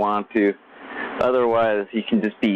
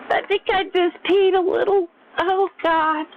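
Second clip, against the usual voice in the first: put-on much higher squealy girl voice; giggly girl quote. Imitating girls for viewers, high squealy girl voice. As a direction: gush it like a girl laughing too hard. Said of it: "Girls love to say that".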